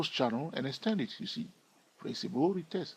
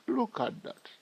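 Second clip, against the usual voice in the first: excited; 9 semitones higher, 4 dB quieter than usual. Real, not acted. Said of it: "Are you excited like myself?".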